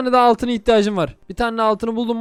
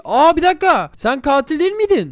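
surprised; 7 semitones higher, 4 dB louder than usual. Real, not acted. Realizes he killed innocent friend.

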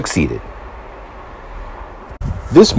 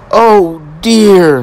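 dismayed; 11 semitones higher than usual. A narrator's reading. Thomas stuck in the hole.

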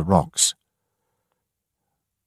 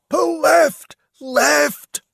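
high, loud shouted order over the storm; a man yelling urgent commands from a rowing boat; William shouting; 4 dB louder, much slower than usual. Acted. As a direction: bark it out over the wind. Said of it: "William shouted".

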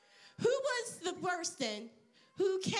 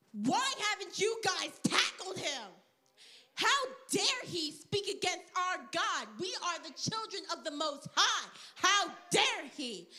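raised pitch, righteous outrage; indignant David; 3 semitones higher than usual. Acted. David scolding the soldiers for tolerating Goliath, indignant. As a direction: demand answers, punching the key words.

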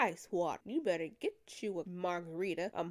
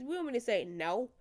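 ordinary talk, nothing marked

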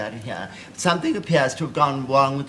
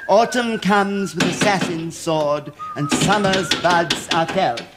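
poetry reading; 5 semitones higher, 4 dB louder, slower than usual. Acted. Reads the second verse.

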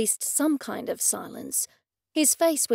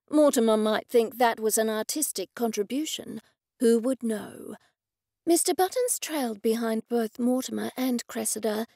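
usual speaking voice

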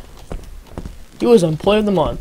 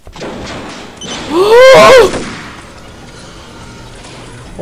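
loud laugh; 9 dB louder than usual. Real, not acted. Laughing at the game dialogue.

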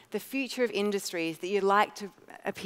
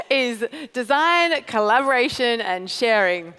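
slow, louder, mock-solemn slogan; mock-grand pitch; 3 semitones higher, 6 dB louder than usual. Founder to audience, reading her old pitch slogan, mock-solemn. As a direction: proclaim it like a cheesy old tagline.

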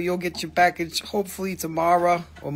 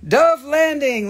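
higher, louder, slow name mangled on purpose; name messed up; 6 semitones higher, 5 dB louder, slower than usual. Pretend. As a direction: stretch the name out to tease it. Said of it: "I gotta make fun of somebody's name".

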